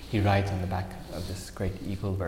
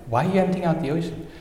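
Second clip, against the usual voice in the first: higher, louder, quick incredulous question; baffled Garuda; 8 semitones higher, 5 dB louder, faster than usual. Acted. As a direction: ask it in utter bewilderment.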